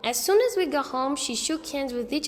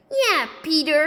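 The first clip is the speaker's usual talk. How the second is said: put-on high, loud smug child; cheeky little boy; 4 semitones higher, 6 dB louder than usual. A narrator's reading. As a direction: echo her smugly. Fudge mimicking Sheila's rebuke.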